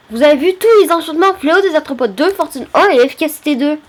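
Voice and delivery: high, excited rush through the list; thrilled. Real, not acted. Thrilled by enchantments on offer. (tout excité, voix plus haute)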